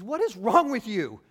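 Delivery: God rebuking a sulking Jonah, loud and exasperated; exasperated scolding. Retold or imitated. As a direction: scold him like a frustrated parent.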